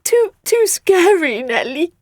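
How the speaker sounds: high, slow whimper. Acted. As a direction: whimper like a frightened creature. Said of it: "whimpered Writhe".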